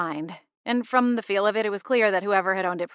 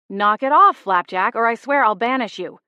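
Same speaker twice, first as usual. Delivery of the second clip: higher, louder warning; louder scolding threat; angry threat; 3 semitones higher, 6 dB louder than usual. A narrator's reading. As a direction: threaten the cat firmly. Threatening the cat.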